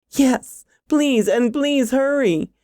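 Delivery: high, drawn-out frightened pleading; desperate plea. A narrator's reading. Frightened woman begging her boyfriend to return, pleading.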